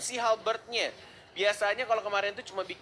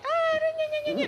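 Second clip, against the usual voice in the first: cutesy high voice; 4 dB louder than usual. Retold or imitated. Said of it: "diem kayak".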